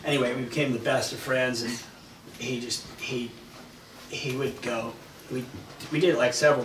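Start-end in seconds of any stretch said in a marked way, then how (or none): none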